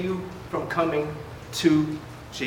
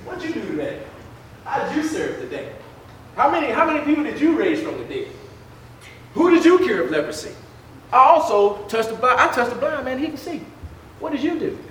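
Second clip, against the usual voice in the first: mock one-upping, pitched up on the challenges; boastful Christ; 6 semitones higher than usual. Christ quizzing his disciples on their service, mock boasting. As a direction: needle them like a playful show-off. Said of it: "what if Christ boasts like we boast".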